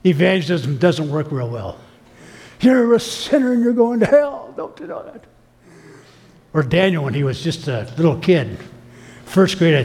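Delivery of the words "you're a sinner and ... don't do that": higher, accusing street preacher voice; hammed-up condemnation; imitating a confrontational evangelist; mock street preacher. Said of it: "Confrontational evangelism".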